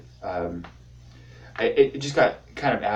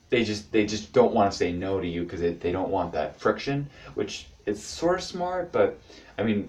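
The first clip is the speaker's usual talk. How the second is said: ordinary talk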